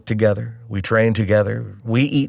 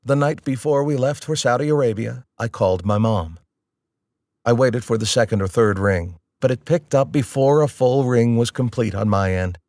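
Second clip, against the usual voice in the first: ordinary talk, nothing marked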